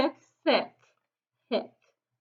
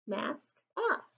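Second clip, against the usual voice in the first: ordinary delivery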